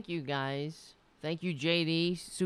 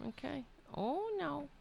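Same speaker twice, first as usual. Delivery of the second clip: cooing at cat; 9 semitones higher, 6 dB quieter than usual. Real, not acted.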